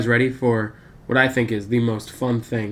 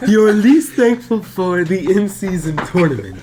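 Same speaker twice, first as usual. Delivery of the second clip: higher-pitched, through laughter; laughing; 4 semitones higher than usual. Real, not acted. Host retries his question after being yelled at, laughing. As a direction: try to finish the line while cracking up. Host repeats line, cracking up.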